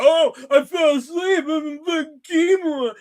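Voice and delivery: high, loud sarcastic shout of his excuse; mocking whiny excuse.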